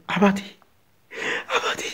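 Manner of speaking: soft, stifled North Korean plea; hushed North Korean prayer. Acted. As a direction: barely voice it, afraid to be heard. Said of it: "조용히 입을 뒤집어 쓰고 소리 안". (북한 말투로 숨죽인 기도)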